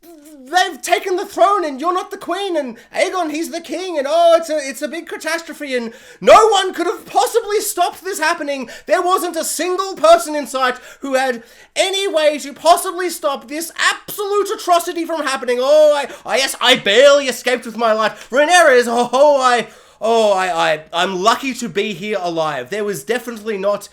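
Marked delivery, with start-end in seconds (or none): 0.0-15.6 higher, louder hammed-up lament; old lady breathlessly reporting the usurpation; dramatic old lady. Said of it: "she's all like". 15.6-22.7 loud, stammering hammed-up fright; old lady faking a narrow escape; mock panic. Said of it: "she's all like".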